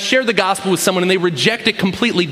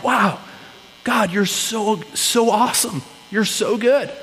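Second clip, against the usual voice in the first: hushed awe; 3 semitones higher, 3 dB quieter, slower than usual. Retold or imitated. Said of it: "sit back in awe of".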